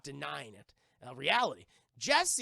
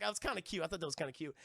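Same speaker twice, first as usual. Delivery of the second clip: ordinary delivery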